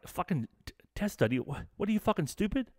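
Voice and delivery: retold scoff at the test study; incredulous outburst. Telling dad about the study, retold exasperated scoff.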